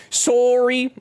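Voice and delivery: a squeaky pretend sorry; high mock apology. Streamer apologising to chat in a high squeaky voice.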